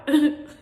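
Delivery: sudden high, loud sob; real sob. Real, not acted. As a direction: burst out with one sharp sob. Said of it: "But what, cry?".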